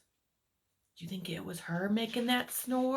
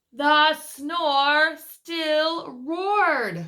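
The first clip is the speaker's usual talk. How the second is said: story narration; 5 semitones higher, 10 dB louder, much slower than usual. A narrator's reading. Reads picture book aloud.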